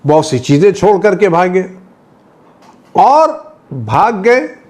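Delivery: high-pitched, ringing sermon delivery; impassioned preaching. Real, not acted. Preacher telling of soldiers fleeing and leaving goods, impassioned.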